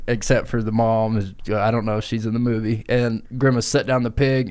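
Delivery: usual speaking voice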